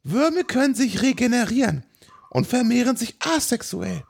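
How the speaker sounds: deutlich höhere, verstellte Forscherinnenstimme; erklärt beschwichtigend als Wissenschaftlerin; hohe Figurenstimme. Acted. Doktor Ye erklärt weiter. (hohe verstellte Stimme, erklärend)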